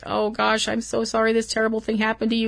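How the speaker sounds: soft, quick, consoling murmur; mock comforting. Comforting a crying man, a soft quick sympathy. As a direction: hush it out fast, like patting his back. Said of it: "I wanna put my arm around him and be like".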